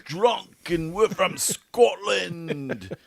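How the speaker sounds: put-on high, loud, slurry English-accented shouting; hammed-up tipsy English fan hollering; mock drunk English accent. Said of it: "we have an English accent".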